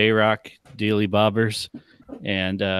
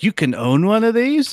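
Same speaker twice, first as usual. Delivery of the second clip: amazed question; 10 semitones higher than usual. Retold or imitated. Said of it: "She's like".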